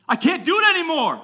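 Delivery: high, very loud acted outburst; mock anguished yell. Teen at the pastor's door about dealing, mock anguished yell. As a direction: yell it as the exasperated kid.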